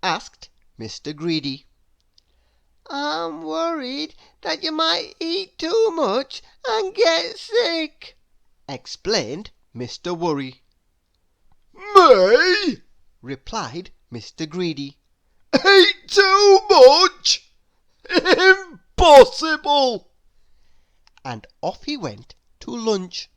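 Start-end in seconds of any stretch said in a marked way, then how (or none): 2.9-8.2 high, near-crying, slowing at the end; tearful high worry; fretful Mister Worry. Acted. Mister Worry voiced in story. 11.7-12.8 loud, high outraged me; loud scandalised glutton; indignant Mister Greedy. Acted. Mister Greedy voiced in story. 15.5-20.1 loud, high, very slow protest; booming mock disbelief; indignant Mister Greedy. Mister Greedy voiced in story.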